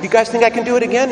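playful circus performer working the crowd; tightrope walker. Acted. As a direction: call out quickly to the crowd as the performer. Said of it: "And he asks".

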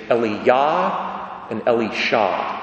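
Eliah and Elisha, each punched on the final syllable; end-stressed "Eliah and Elisha"; stress on last syllable. Shown as a demonstration. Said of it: "with the emphasis on the last syllable".

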